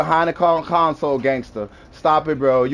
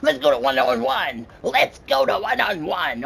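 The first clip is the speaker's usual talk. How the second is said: mocking the opponent; 4 semitones higher than usual.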